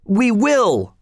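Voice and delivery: the crowd agrees to rebel, crying out. A narrator's reading. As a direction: answer as one eager crowd. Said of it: "cried everybody".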